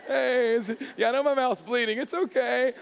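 high, forced-cheerful reassurance; strained, over-friendly brave face; fake smile. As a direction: insist all is fine through a pasted-on smile. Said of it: "one of those fake smiles".

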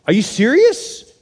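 disbelieving question, pitch climbing high; incredulous. Acted. Abraham questioning God's shocking command, incredulous. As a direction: stretch it out in stunned disbelief.

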